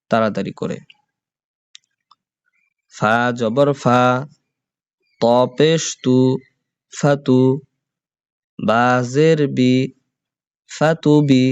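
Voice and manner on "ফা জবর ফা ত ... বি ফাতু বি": teacher's chanted letter-by-letter recitation; spelling drill. Shown as a demonstration. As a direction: spell out each syllable for the class to repeat. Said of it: "তাড়াতাড়ি হবে".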